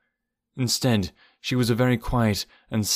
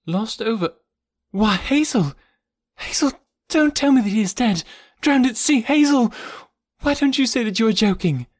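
high-pitched, distraught outcry; shocked disbelief; 13 semitones higher, 4 dB louder than usual. Acted. Jane learning of a drowning at sea, shocked and pleading. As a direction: rise from stunned gasping words into desperate pleading. Shocked at news of death, then faints.

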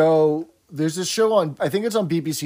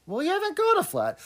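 quoting sitcom lady; 4 dB quieter, faster than usual. Retold or imitated. Quoting sitcom characters' exchange.

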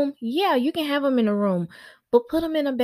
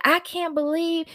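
higher, slow indignant mock voice of angry critics; mocking mad critics; 8 semitones higher than usual. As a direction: parody the outraged commenters, pitched up. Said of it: "And those people were so mad".

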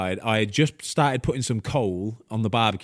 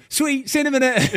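much higher, breezy mock; chirpy agent; 13 semitones higher, 4 dB louder than usual. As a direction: toss it off cheerily like it's nothing. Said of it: "And you've gone".

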